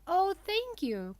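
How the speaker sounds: high, loud, drawn-out thanks; grateful cry. A narrator's reading. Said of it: "cried Pinocchio".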